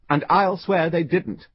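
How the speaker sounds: dogged. A narrator's reading. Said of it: "said the dogged passenger".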